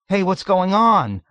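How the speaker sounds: high, loud anxious plea; pleading. A narrator's reading. Hungry Joe scared amid the commotion, pleading. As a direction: beg loudly to be told. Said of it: "Hungry Joe pleaded".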